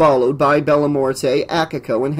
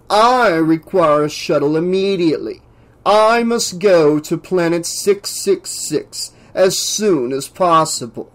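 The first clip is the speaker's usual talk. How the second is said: pompous scientist, demanding; 3 semitones higher than usual. Acted. Professor demands a shuttle from the pilot, imperious. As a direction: issue a self-important order.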